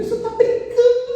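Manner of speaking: half-laughing, panicked protest to the doctor; mock despairing outcry; desperate disbelief. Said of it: "eu fiquei tão desesperada".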